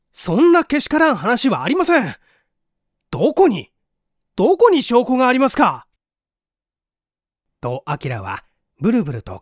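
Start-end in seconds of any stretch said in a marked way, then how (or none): 0.1-5.9 rising, louder outraged denial; indignant protest. Acted. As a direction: protest your innocence hotly. Boy indignantly denies.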